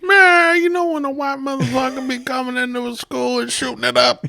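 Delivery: high squeezed standup cadence with stretched vowels; Richard Pryor. Acted. Comedian friend riffing as a dead comic, Richard Pryor impression. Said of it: "I gotta work on my prior".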